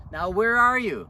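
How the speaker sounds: very loud, high shout into the dark; loud call. Retold or imitated. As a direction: bellow it out to find him. Husband calling into dark yard.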